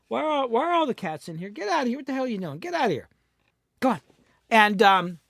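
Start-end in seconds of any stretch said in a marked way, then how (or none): 0.1-4.0 higher pitched, exasperated scolding of pets; shooing the cats. Real, not acted. To the cats wandering into the studio, higher exasperated shooing. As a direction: shoo the cats out, half annoyed.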